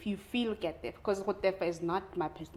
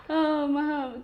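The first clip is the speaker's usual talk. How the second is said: mock whine; 8 semitones higher, 5 dB louder than usual. Mock whiny crier.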